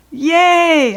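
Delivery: put-on high, loud child's yay; excited cheer. A narrator's reading. Child cheers at staying in pool.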